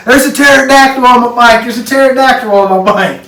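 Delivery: high, loud mock yell of alarm; retold panicked scream. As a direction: imitate a scared kid yelling for help. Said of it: "screaming and crying".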